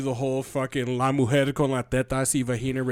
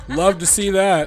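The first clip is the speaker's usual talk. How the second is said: laughing; 9 dB louder than usual. Real, not acted. Laughing reply.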